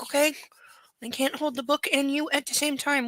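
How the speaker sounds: normal talking voice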